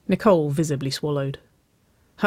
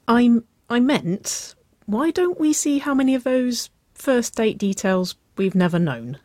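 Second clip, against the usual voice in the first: higher-voiced woman, stumbling then suggesting; high, halting start into a playful suggestion; flustered Nicole; 8 semitones higher, 3 dB louder than usual. A narrator's reading. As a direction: correct yourself, then pitch the idea brightly.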